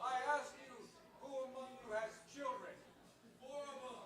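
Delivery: distant, thin voice as if shouting across a hall; no microphone. Shown as a demonstration. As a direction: project from far away without a mic. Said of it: "Without a microphone, it's".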